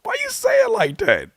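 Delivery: very high-pitched disbelief; incredulous squeal. Real, not acted. Reactor marvelling at a lyric's delivery, incredulous high squeal.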